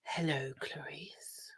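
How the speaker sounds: low breathy whisper, drawn out; spooky Hannibal Lecter. Pretend. Friend hams up Hannibal Lecter in a whispered creepy voice. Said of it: "I was trying to be spooky".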